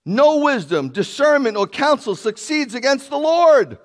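ringing sermon voice; full-force preaching. Acted. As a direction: drive the point home to the congregation.

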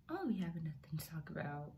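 fast whispered admission; whispered confession. Real, not acted. Vlogger whispering that she has nothing to say. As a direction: let it slip out under her breath.

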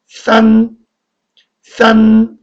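싼 held high and level; first-tone demo. Shown as a demonstration. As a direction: sustain 싼 on one high pitch. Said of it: "일 성, 싼".

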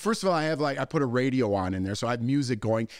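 ordinary delivery

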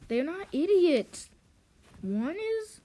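high, slow, emphatic protest; mock offended, swooping high; indignant correction. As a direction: correct them with exaggerated emphasis.

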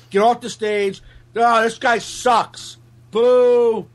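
mock heckler jeer, high and drawn out; lightly voiced drunk heckler booing; heckler yelling. Comic imitating hecklers booing him, mild mock jeer. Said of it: "That's what I'm hearing".

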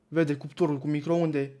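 usual speaking voice

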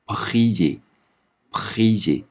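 prier said slowly, each syllable drawn out; slow French demo. Shown as a demonstration. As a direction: sound out prier slowly and clearly. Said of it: "所以它读". (慢速示范读prier)

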